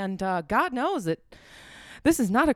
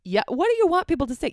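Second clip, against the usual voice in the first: mock retort; 8 semitones higher, 5 dB louder, faster than usual. Mock-arguing with imagined complainers.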